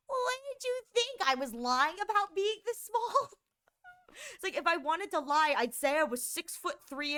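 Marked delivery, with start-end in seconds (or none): 0.1-3.3 mock indignant. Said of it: "I'm like".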